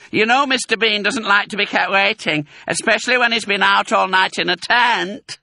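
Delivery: high, loud, carrying scold; woman calling out. A narrator's reading. Woman hurries Mabel along, calling loudly. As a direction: keep calling through the house. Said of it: "the voice called".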